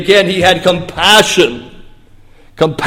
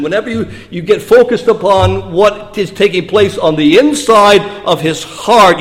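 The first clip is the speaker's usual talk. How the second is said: ordinary delivery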